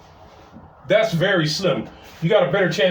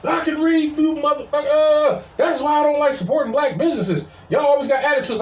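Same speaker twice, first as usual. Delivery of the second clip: high-pitched retold yelling, little added volume; mock yelling; 9 semitones higher than usual. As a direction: imitate an offended customer yelling back. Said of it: "Then she started yelling".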